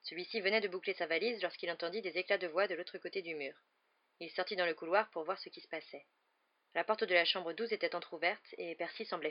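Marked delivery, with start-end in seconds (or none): none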